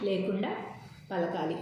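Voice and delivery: ordinary talk, nothing marked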